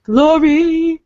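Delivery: high, loud drawn-out call; playful calling out. Pretend. Voice actor playfully calling out her good friend's name, exuberant.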